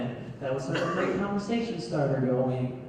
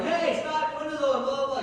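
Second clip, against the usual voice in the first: imitated yelling; 9 semitones higher than usual. Drive-thru man after a departing car, mock yelling. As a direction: call after her in a high, mock-alarmed voice. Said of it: "yelling at her, like".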